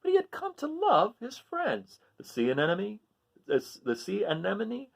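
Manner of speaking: lively picture-book reading, rising on each list item; story narration. A narrator's reading. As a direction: read it to children, animated and sing-song. Reads picture book aloud.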